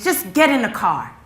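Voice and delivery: put-on loud burst at the kids; stressed mother losing patience; impatient order. Snaps at kids while distracted.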